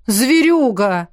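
slow, drawn-out reproachful cry; wounded name-calling; indignant. A narrator's reading. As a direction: stretch the insult out in outrage. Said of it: "возмутился эльф".

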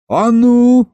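loud, high commanding shout; yelling. A narrator's reading. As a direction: yell to halt them. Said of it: "заорал я". (громкий окрик, заорать)

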